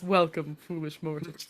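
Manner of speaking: a hint of the low ghost host; lightly voiced spooky greeting; booming demon lord. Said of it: "that opening that starts when".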